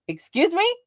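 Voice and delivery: high-pitched mock disbelief; incredulous. Wife reacting to husband's odd comparison, incredulous.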